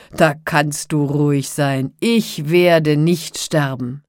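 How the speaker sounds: loud, slow boast; amused, confident giant. A narrator's reading. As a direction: boast slowly as an untouchable giant. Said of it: "antwortete der Riese und lachte". (laut prahlender Riese)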